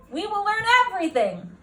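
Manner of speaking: an ecstatic principal announcing to the whole school; booming principal. Acted. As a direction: proclaim it like an overjoyed headmaster. Principal character voice.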